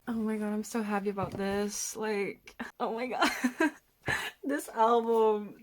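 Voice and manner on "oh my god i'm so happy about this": ordinary talk, nothing marked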